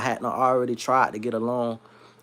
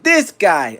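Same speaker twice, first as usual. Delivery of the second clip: loud, higher attempt at a Yiddish accent; badly faked Yiddish accent of a boss; 4 semitones higher, 6 dB louder than usual.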